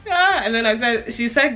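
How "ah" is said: laughing gasp. Retold or imitated. Laughing reaction to teacher quote.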